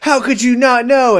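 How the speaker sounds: high-pitched indignant outcry; mock indignation. Daughter confronts oblivious mother, high mock indignation.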